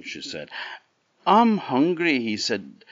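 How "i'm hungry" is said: put-on much higher, loud boyish greeting; Jack's greeting. A narrator's reading. Jack greets the tall woman at the castle, high and bright. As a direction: sing it out like a cheeky lad.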